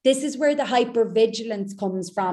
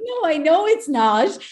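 louder, laughing as you agree; laughing agreement; 7 semitones higher, 7 dB louder than usual. Retold or imitated. Coach laughing along with her client's admission. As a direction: chuckle knowingly back.

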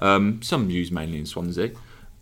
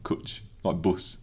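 Brummie accent; 2 semitones lower, 6 dB quieter, slower than usual. Shown as a demonstration. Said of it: "That's my Brummie accent coming out".

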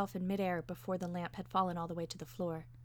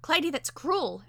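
raised, louder objection; protest; 7 semitones higher, 7 dB louder than usual. A narrator's reading. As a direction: object sharply to the cruelty. Narrator's character protesting the squeezing.

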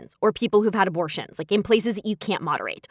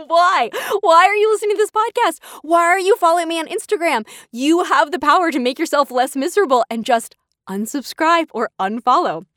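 acted exasperation, pitched well up; exasperated disbelief; 8 semitones higher than usual. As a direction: plead with a hater in baffled exasperation. Acted exasperated reply to negative reviewers.

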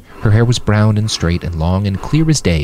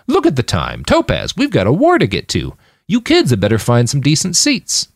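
higher, showy fake realization; breezy feigned astonishment; mock surprise; 7 semitones higher than usual. A narrator's reading. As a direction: play up pretend surprise to hurry everyone along. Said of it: "he said in mock surprise".

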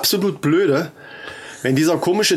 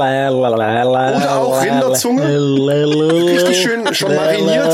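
butcher voice. Pretend. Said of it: "rede wie ein Fleischverkäufer".

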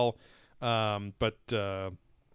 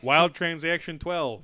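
mock trailer announcer; 5 semitones higher, 5 dB louder, slower than usual. Mock movie title announcement.

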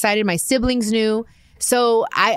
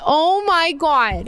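high, drawn-out swooning; slow, high-pitched gush of emotion; 10 semitones higher, much slower than usual. Real, not acted. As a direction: stretch it out high and overwhelmed. Gushing over his proposal words.

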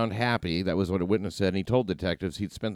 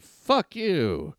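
higher-pitched mock yell; half-shouted retort, pitched up; retold yell; 8 semitones higher, 4 dB louder than usual. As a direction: act out a stunned victim's yell. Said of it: "Johnson yelled".

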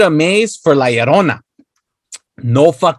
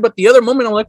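ordinary talk, nothing marked

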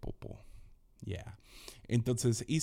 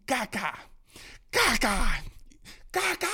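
put-on high, loud, gleeful toddler shouts; mock toddler yelling caca; imitating a child's yell; 12 semitones higher, 4 dB louder, slower than usual. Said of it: "Y empieza a gritar".

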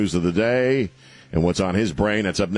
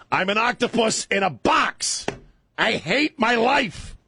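very high, slow squeaky voice for a sad octopus; slower than usual. Pretend. Host voicing the octopus in the painting, high and slow. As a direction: squeak it out slowly like a miserable little octopus. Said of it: "The octopus is really trying to say".

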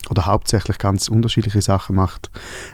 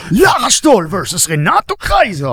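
slow, higher booming announcer call; booming fighting game announcer; 8 semitones higher, 3 dB louder, slower than usual. Pretend. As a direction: announce the match like an arcade fighter. Mock fighting game match intro.

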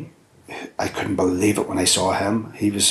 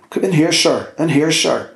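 higher, louder retold call of soldiers; raised voice calling the others in; 7 semitones higher, 3 dB louder than usual. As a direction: call them in like a soldier pointing someone out.

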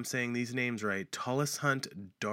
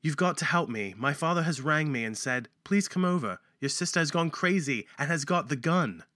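higher, louder put-on British accent; 4 semitones higher, 4 dB louder than usual. Shown as a demonstration. Host rereading the caller's plea to police, in a British accent. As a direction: read it out in a performed British accent. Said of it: "with my British accent".

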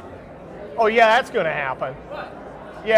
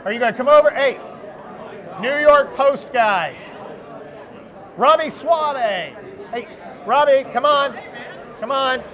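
calling out; 5 semitones higher, slower than usual. Real, not acted. Calling Robbie over to podcast.

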